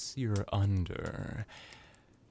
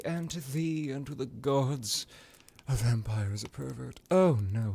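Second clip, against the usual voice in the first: hammed-up theatrical lament, pitched up; sarcastic mock horror; 3 semitones higher, 3 dB louder than usual. Vampire admitting being a pervert, in sarcastic mock dismay. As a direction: wail it like feigned scandal, with heavy emphasis.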